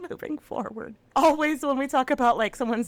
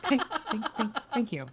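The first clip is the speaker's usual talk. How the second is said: stammering through laughter; laughing; 3 semitones higher, 6 dB quieter than usual. Real, not acted. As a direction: giggle out the thanks. Repeats the line laughing.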